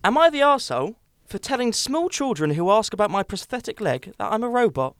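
usual speaking voice

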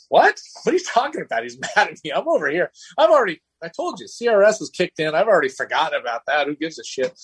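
high, squeaky mock outrage; incredulous disbelief. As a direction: act baffled that anyone still cares. Retelling his shocked reaction.

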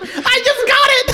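high, laughing realization. Real, not acted. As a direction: crack up as it clicks.

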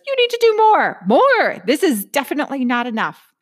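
high, loud driven taskmaster voice; nagging inner saboteur. Acted. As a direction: bark orders like a relentless perfectionist. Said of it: "my hyperachiever saboteur pirate says".

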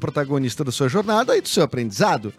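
upbeat radio ad read. Acted. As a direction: read it like a sponsor spot. Reads sponsored ad.

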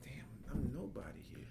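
breathy dejected whisper. Retold or imitated. Back among the stars feeling like nobody, whispered. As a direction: whisper it, crushed and deflated.

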